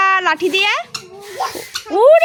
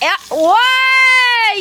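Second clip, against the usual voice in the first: calling out; 4 semitones higher, much slower than usual. Real, not acted. Mother shouts for her child playing at the tap.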